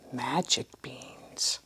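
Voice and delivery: slow secret whisper. A narrator's reading. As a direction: hush it like a secret. Storyteller hushes secret.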